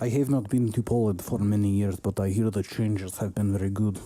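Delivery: deadpan mock Polish accent; put-on Polish accent for a joke. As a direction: play a Polish speaker, accented and understated. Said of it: "The Polish".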